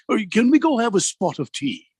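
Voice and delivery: high, put-on posh English accent; hammed-up British accent; mock British. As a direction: play a stuffy Brit asking for tea. Mocking British speech.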